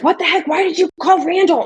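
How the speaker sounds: high-pitched, baffled scolding; indignant mom. Retold or imitated. Mom asks daughter about the restaurant call, indignant. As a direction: imitate mom's exasperated questioning. Retold mom's indignant phone reaction.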